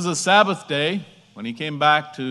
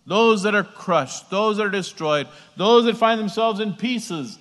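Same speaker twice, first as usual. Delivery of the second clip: raised, higher preacher's voice; emphatic preaching; 5 semitones higher, 5 dB louder than usual. Real, not acted. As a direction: proclaim it to the congregation with conviction.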